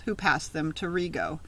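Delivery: plain reading voice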